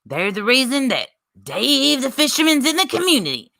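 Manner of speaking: hammed-up New York accent, punched opening. Streamer reading out a rival's post, mock New York accent. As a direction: put on a rough attempt at New York. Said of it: "this New York accent".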